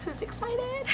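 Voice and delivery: high, squealy excited question. Real, not acted. Bubbly hype squeal before bid day.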